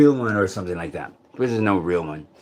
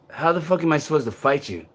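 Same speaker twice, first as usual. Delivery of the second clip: ordinary talk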